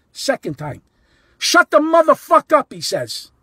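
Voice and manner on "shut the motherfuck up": loud, high-pitched mock shout; screaming, hollering. Ballplayer swearing at a fan, imitated shout. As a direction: yell it like an irate ballplayer. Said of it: "screaming, hollering".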